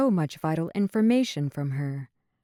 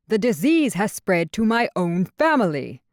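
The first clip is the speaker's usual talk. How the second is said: loud, higher anguished shout; 4 semitones higher, 5 dB louder than usual. Acted. King shouting to the captain about his sick family, loud shout. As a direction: shout it out in desperation. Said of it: "Papa shouted from within the crystal".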